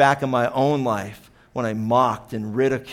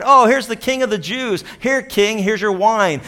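soldiers mocking; 7 semitones higher, 5 dB louder than usual. Preacher voicing soldiers taunting Jesus, mock-royal jeer. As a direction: sneer it like a soldier offering sour wine. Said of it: "the soldiers in verse thirty-six also mocked him".

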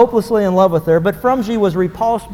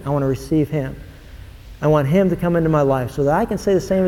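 sinner's prayer; 4 semitones lower than usual. Acted.

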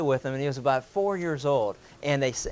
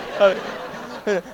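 breathy laugh; 6 semitones higher, 4 dB louder than usual. Real, not acted. Laughing at own joke.

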